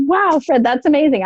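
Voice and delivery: high-pitched, impressed wow; acted amazement. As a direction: re-enact being wowed by someone's news. Acting out amazement at Fred's job.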